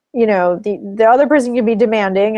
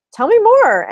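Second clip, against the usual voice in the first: much higher, louder eager invite to go on; inviting prompt; 13 semitones higher, 6 dB louder than usual. Shown as a demonstration. Therapist coaxing angry partner, high eager invitation. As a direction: pull more out of them eagerly. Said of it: "I'll validate their anger and go".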